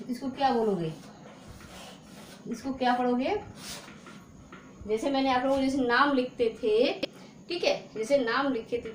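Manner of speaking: usual speaking voice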